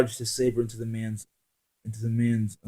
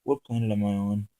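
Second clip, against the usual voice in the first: blank tone. Acted. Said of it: "asked in a blank tone".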